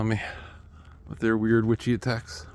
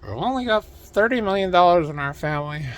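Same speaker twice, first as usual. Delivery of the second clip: mock rich executive; 5 semitones higher, 4 dB louder than usual. Mocking rich game companies.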